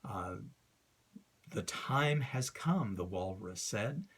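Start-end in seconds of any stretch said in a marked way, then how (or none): 1.5-3.0 slow, with the beat on "time" and "come"; iambic recitation. Shown as a demonstration. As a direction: recite it to show the ta-dum rhythm. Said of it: "You can hear in the recitation the ta-dum".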